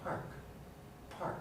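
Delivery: very soft PARK, P still aspirated; hushed word with a puff on the P; quietly. Shown as a demonstration. Teacher showing volume doesn't matter, quiet park. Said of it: "even quietly".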